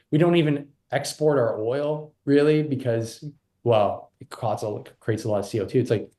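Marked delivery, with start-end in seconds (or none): none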